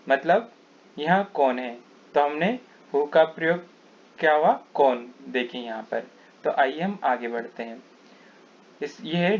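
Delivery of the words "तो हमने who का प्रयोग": normal talking voice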